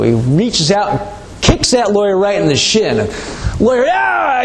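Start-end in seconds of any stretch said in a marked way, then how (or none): 3.9-4.4 high-pitched mock yell of pain; mock pain cry. Lawyer kicked by farmer, high mock howl.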